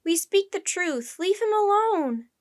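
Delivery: high, slow, loud appeal; shouted plea. A narrator's reading. Annie defends the octopus, shouting slowly. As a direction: drag out the words in a loud protest. Annie pleads with armed crew.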